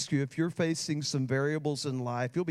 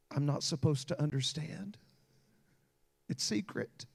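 tearful whisper. Retold or imitated. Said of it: "just began to weep".

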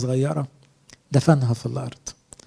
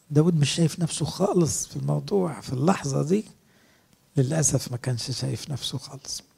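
ordinary talk, nothing marked

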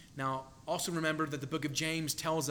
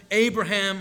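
a loud call of the name; 4 semitones higher, 8 dB louder than usual. Retold or imitated. Preacher as God calling out to Abraham, loud. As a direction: call his name across the distance. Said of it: "God calls out to him".

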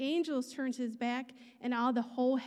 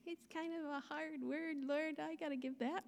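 hushed aside; 2 semitones higher, 8 dB quieter than usual. Retold or imitated. Hesitant aside to the Lord.

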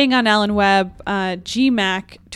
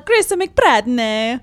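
slow, hammed-up Italian accent; 2 semitones higher, much slower than usual. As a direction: put on a cartoonish Italian restaurant-owner accent. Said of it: "your Italian accent".

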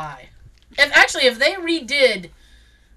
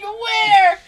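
high, loud mock echo of Where; a loud squeaky parody of the question; mocking shout; 11 semitones higher, 6 dB louder than usual. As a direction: shout Where back to tease. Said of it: "He's like".

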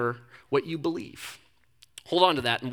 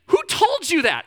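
incredulous question; 13 semitones higher, 5 dB louder than usual. Retold or imitated. Uncle questioning niece.

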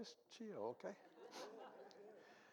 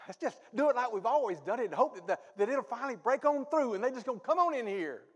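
fiery preaching; 7 semitones higher, 11 dB louder than usual. Real, not acted. Preaching at full force.

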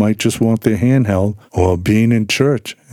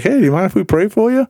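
sweet friendly ask; 11 semitones higher, faster than usual. Retold or imitated. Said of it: "We're like".

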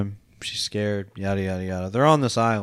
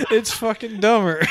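laughing; 10 semitones higher than usual. Real, not acted. Still laughing about the twist.

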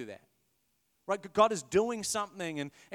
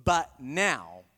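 sassy but now; 5 semitones lower, 4 dB louder than usual. Retold or imitated. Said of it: "there's some attitude there".

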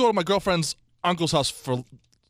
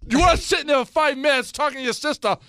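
told-you scolding; 8 semitones higher than usual. Retold or imitated. Fighting with girlfriend.

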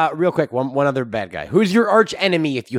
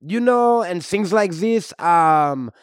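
put-on comic French-Canadian accent; hammy Québécois hero voice. French-Canadian Wolverine character.

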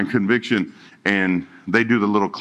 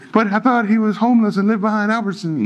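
mock naive listener; 10 semitones higher than usual. Imagined congregant's naive objection.

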